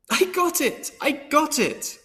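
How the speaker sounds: very high, thrilled cry; overjoyed shout of good news; excited scream. A narrator's reading. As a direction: burst out with the news. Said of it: "I screamed".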